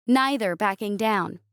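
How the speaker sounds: ordinary delivery, nothing marked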